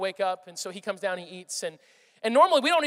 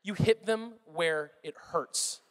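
very slow, every word weighted; slow emphatic; 3 semitones lower, much slower than usual. Real, not acted. Pastor insisting to parents on discipline, slow and deliberate. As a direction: drag it out to make the point land. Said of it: "no, no, no, no".

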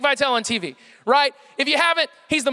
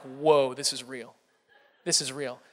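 low, soft, awestruck; retold moment of quiet amazement; hushed awe; 10 semitones lower, 6 dB quieter, slower than usual. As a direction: let it sink in quietly, in disbelief.

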